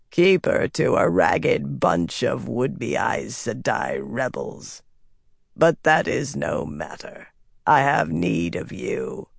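slow, low, scornful rasp; rasping dark figure. A narrator's reading. Dark figure scorning then claiming its servant, rasping. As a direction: rasp it out slowly, sinking at the ends. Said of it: "a rasping voice said".

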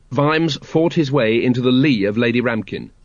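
ordinary delivery, nothing marked

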